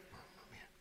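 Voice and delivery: a breathless whispered mamma mia; awed whisper. Real, not acted. Preacher marvels to the congregation in a whisper. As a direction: whisper it in awe. Awed aside after mockery.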